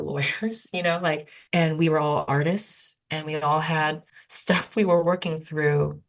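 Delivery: usual speaking voice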